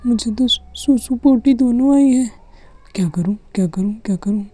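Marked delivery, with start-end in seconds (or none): 2.9-4.4 panicked whisper. Acted. Panicking child.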